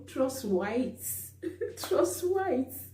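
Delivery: laughs through "trust whites"; giggling at the memory; amused, laughing aside. Real, not acted. Laughing over neighbors calling police.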